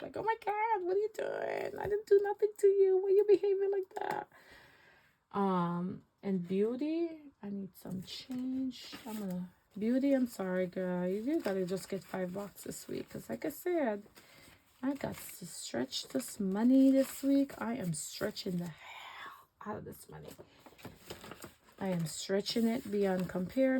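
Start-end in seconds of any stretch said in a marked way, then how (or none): 0.2-4.3 high, fast fake-innocent outrage; mock shocked. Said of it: "act surprised and, like, all shocked". 17.6-20.3 whisper. Real, not acted. Aside about stretching money.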